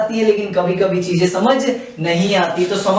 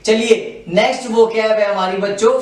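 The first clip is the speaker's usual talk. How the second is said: ordinary delivery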